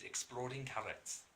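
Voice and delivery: drawn-out attempt at a Welsh accent; botched Welsh accent. Shown as a demonstration. Vlogger showing the most Welshy thing to say, botched Welsh accent. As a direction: stretch it out in a badly done Welsh accent. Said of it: "I just ruined that accent".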